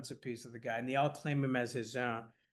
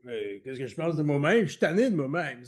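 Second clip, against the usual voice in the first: Kerouac's Québécois French, louder; voicing Kerouac; 5 dB louder than usual. Kerouac answering the host, louder in Québécois French. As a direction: hand over the tired reply in his voice. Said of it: "and he's like".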